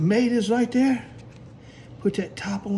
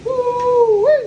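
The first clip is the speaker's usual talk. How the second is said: excited whoop; 7 dB louder than usual. Real, not acted. Excited over frying fries.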